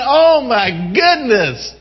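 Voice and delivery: high, eager voice of God; impassioned pleading, pitch climbing high; excited exclamation. Acted. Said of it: "So He gets excited".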